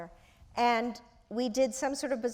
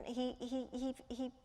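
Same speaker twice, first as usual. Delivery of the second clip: normal talking voice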